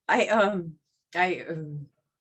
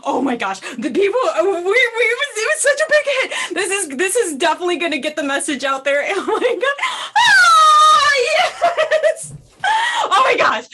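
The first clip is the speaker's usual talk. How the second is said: loud, laughing, breathless with joy; giddy loud celebration; excited shouting; 6 semitones higher, 11 dB louder than usual. Acted. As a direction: whoop it up through happy tears. Celebrating the big success together.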